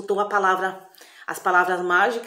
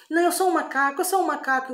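put-on higher, dazed boy's voice; confused boy after the potion, higher voice; boy who thinks he's a monkey; 8 semitones higher than usual. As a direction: insist you're a monkey, pitched up.

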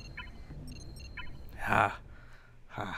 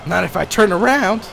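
loud cocky shout; 11 semitones higher, 14 dB louder than usual. Real, not acted. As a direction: yell it as a dare. Loud gaming taunt.

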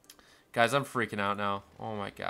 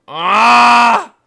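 loud, high scream; sustained high yell of frustration; frustrated scream; 13 semitones higher, 22 dB louder than usual. Real, not acted. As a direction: scream out at full volume. Gamer screams at the crisis.

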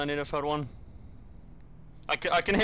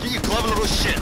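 yelling; 8 semitones higher, 8 dB louder than usual. Real, not acted. Outwitted by enemy in game.

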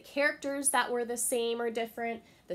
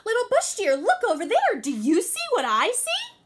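much higher, louder coaxing trickster; eager Anansi; 11 dB louder than usual. Acted. Anansi pointing out the rock to Little Bush Deer, eager and high. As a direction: lure her in, excited.

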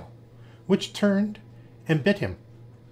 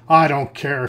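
a louder snarl, wolf bullying the lamb; 7 dB louder, slower than usual. A narrator's reading. Wolf snarls at the little lamb, angry and louder. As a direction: bite the words off like a hungry wolf. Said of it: "snarled the wolf".

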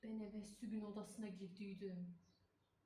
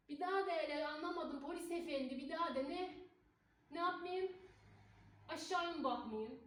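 loud, higher, defiant challenge; defiant shout at police; 6 semitones higher, 3 dB louder than usual. Acted. Cleaner shouting back at the police officer, defiant and loud. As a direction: dare the officer to repeat it.

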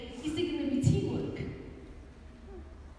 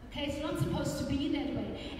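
usual speaking voice

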